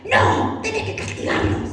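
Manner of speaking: high, half-whispered mock complaint; a put-on outraged Jonah; mock indignant Jonah. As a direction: pastor hamming up Jonah's anger. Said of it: "se enojó porque se arrepintieron".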